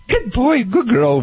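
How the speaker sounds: bright high-pitched praise for a puppy; sing-song dog praise. Shown as a demonstration. Praising dog while it poops.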